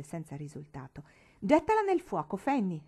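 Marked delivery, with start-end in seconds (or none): 1.4-2.8 put-on loud high boy's yell; shouted order. Tom yelling at Fanny, a loud high shout. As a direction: yell it at his sister. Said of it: "gridò Tom".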